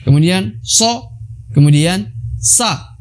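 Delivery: slowly naming so and sa; slow recap. Shown as a demonstration. Teacher slowly recapping the letters. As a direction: spell it out slowly for learners. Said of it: "Jadi yang pertama tadi za".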